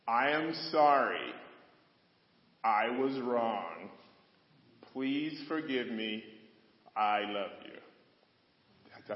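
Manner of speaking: each phrase spoken slowly with long pauses; slow, deliberate formula. Shown as a demonstration. Pastor teaching couples the phrase, slow deliberate delivery. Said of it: "statements go like this".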